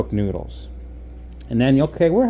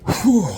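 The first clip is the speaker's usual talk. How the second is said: relieved whew; 10 semitones higher, 3 dB louder than usual. Real, not acted. Relief after finishing exposure.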